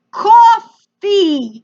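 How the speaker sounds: COFFEE in a loud, drawn-out mock American accent; attempted American accent. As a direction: imitate a foreigner trying hard at an American accent. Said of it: "tries to say it with an American accent".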